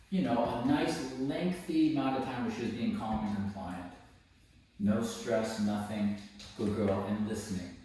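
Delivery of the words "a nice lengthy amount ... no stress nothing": usual speaking voice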